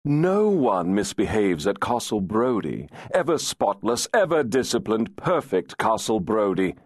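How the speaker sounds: high, taunting sing-song; mock his perfect castle. Adam mocks the laird.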